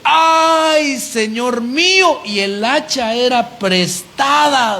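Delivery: high, drawn-out wail of dismay; lamento dramatizado. Retold or imitated. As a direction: cry out in panic, stretching the vowels. Retells the axe borrower's cry of dismay. (lamento agudo y alargado, angustiado)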